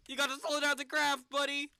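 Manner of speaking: loud, pleading; urgent yell. Real, not acted. Panicking as the capsule falls.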